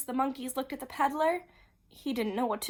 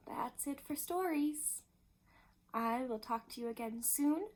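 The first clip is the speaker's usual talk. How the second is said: usual speaking voice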